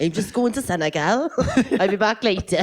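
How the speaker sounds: put-on laughing through a Welsh accent. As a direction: put on a sing-song Welsh lilt. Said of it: "if you do a fucking Welsh accent".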